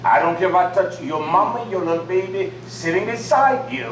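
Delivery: ordinary delivery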